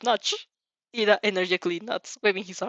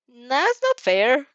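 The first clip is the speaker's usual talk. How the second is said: slow, drawn-out, louder mock protest; playful jab; 4 semitones higher, 5 dB louder, much slower than usual. A narrator's reading. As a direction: tease her with fake outrage. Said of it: "Zero playfully jabs".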